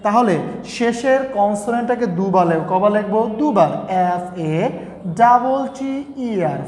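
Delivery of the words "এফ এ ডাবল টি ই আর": slow, letter-by-letter spelling. Shown as a demonstration. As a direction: spell it out slowly for the class. Spells the word for students. (ধীরে ধীরে বানান করে)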